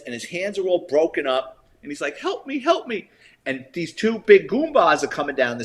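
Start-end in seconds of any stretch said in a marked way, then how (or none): 2.2-3.1 high, thin mock plea for help; pleading for help. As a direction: imitate a hurt man begging, lightly. Beaten man begging in story.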